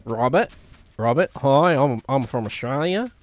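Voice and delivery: put-on lightly nasal Australian accent; Australian Robert impression. Streamer imitating co-host Robert with an Australian accent. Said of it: "I'm gonna do an impression of Robert".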